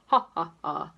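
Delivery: deep, loud, hearty ha ha ha; booming laugh. A narrator's reading. Mister Miserable laughs for the first time, big and booming. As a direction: let out a huge booming laugh. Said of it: "enormous laugh".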